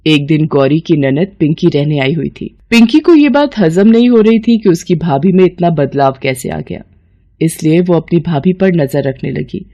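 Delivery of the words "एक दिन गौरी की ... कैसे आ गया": ordinary delivery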